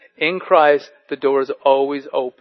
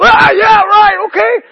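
much higher, louder eager yelps; excited kids; 8 dB louder than usual. Retold or imitated. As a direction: squeal it like thrilled boys. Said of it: "all my guy friends are going".

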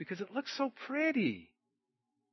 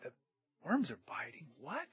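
puzzled thought; 11 dB quieter than usual. Retold or imitated. Driver thinks back a mile later, puzzled. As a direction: puzzle it over to yourself, then a rising double take. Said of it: "he thought".

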